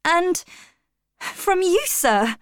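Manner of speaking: usual speaking voice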